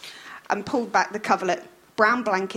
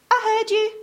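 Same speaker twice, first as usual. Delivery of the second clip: muffled; 13 semitones higher, 5 dB louder than usual. Acted. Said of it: "said Sally's muffled voice".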